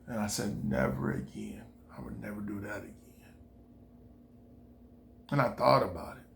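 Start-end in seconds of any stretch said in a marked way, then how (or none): 0.7-3.3 unvoiced whisper, low and firm; whispered vow. Home at last, whispering a vow never again. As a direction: whisper the promise to yourself. Vowing after escaping her.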